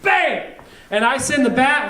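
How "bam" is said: high-pitched exclaimed sound effect; punchy impact cry. Retold or imitated. Preacher acting out the tennis racket whack, punchy cry. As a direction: hit it high, like the racket landing.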